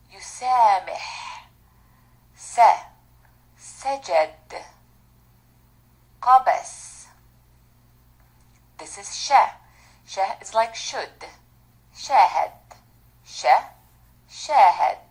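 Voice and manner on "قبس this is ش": ordinary delivery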